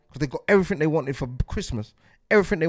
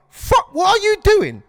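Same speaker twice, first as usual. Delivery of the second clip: exasperated call-out; 13 semitones higher, 7 dB louder, slower than usual. Retold or imitated. Women calling out other women.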